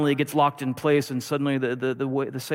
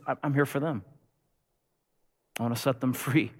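quiet resolve; 5 dB quieter than usual. Acted. Rescuer answers the master.

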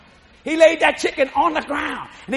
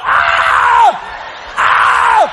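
long, high, loud wails of panic; mock screams; 9 semitones higher, 6 dB louder than usual. Boy chased by the dead chicken, hammed-up screaming. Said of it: "I'm, I'm going".

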